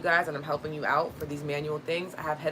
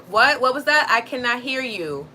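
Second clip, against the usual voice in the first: loud, high, can't-hear-you voice; mock puzzled shout, slowing at the end; feigned deafness; 6 semitones higher, 9 dB louder than usual. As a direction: call out as if over headphones. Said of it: "have my headphones in and be like".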